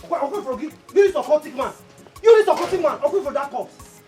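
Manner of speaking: loud, high accusing yell that keeps building; angry accusation. Acted. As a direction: shout him down as a sorcerer. Accusing the occultic man of sorcery.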